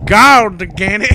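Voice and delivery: loud, slow mock preacher shout; hammed-up preacher. Friend quoting a viral preacher, loud mock preaching. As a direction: shout it like a fire-and-brimstone preacher.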